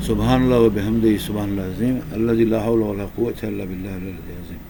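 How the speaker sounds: low, soft devotional recitation of Allah's names; murmured dhikr. Acted. Storyteller recites dhikr in a low, soft murmur. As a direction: chant the praises quietly, turned inward.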